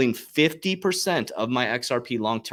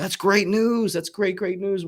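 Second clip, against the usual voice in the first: high, bright burst of excitement; pumped about great news; excited; 9 semitones higher, slower than usual. Real, not acted.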